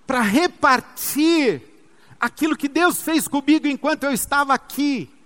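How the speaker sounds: impassioned pulpit proclamation; forceful preaching. Real, not acted. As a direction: build it up like a preacher's declaration.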